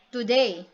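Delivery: "today" with the stress on DAY; light "to", strong "day" in "today"; rising meter. Shown as a demonstration. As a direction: lean on the last syllable of "today". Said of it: "from an unstressed syllable to a stressed".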